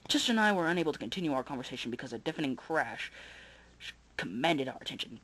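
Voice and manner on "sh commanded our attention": usual speaking voice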